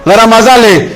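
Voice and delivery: an onlooker raising his voice to halt a worker; calling out. Retold or imitated. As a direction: shout after him to wait.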